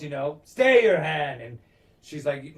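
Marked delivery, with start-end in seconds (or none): 0.4-1.4 loud acted royal command; raised voice, imitating a yelled order; retold yell. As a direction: call out a halt like a king. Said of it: "the king yells".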